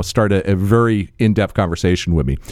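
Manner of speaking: ordinary delivery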